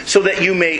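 ordinary talk

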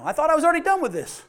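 high and fast, a put-upon whine; exasperated complaint. Acted. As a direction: rush it out, fed up. Believer complaining to God.

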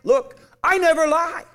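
high, loud, insistent divine declaration; God's emphatic voice. Acted. Voicing God's emphatic oath.

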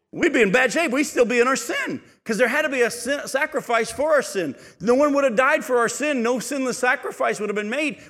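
high, loud preacher's cry; full-force preaching. Acted. Preacher urging the church, fervent preaching. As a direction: drive the point home to the congregation.

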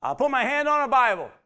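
loud, high, insistent oath; Peter, cornered, shouting his denial; Peter's desperate oath. Retold or imitated. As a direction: swear it loudly like a cornered man denying. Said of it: "That's what he actually said".

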